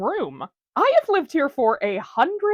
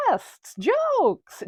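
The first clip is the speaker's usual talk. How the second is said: ordinary delivery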